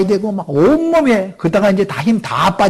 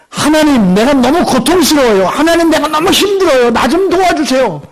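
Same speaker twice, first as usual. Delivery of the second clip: higher, imploring cry to God; pleading prayer; 7 semitones higher than usual. Acted. Believer crying out to God for help, higher pleading. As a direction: beg for help with rising urgency. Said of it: "라고 했을 때".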